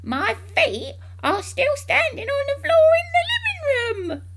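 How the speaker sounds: put-on high, loud, crowing old-lady voice; cackling old woman. A narrator's reading. Grandma stuck through the roof correcting the mother, cackling. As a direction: crow it high and loud, gleefully. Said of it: "cackled the old woman".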